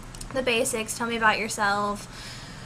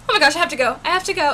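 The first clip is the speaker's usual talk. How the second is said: higher, louder urgent outburst; re-enacted panicky urgency; frantic excitement; 5 semitones higher, 6 dB louder, faster than usual. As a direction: act out rushing off in a flurry. Acting out rushing off after email.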